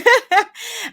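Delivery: a short bright burst of laughter; real laugh. Real, not acted. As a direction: laugh it off, embarrassed. Laughs after muting notifications.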